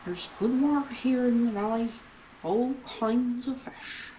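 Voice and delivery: put-on jovial old-salt accent with a chuckle; [name]'s put-on accent. Shopkeeper inviting newcomer to his shop, put-on fisherman's accent. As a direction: play up the friendly fisherman's accent. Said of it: "I don't know why I'm doing this accent".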